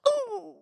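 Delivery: very high, soft drawn-out squeal; high-pitched "ooh". Acted. Woman hearing someone's favorite is the movie she hates, high squeal. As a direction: let a pained ooh rise way up. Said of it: "I go".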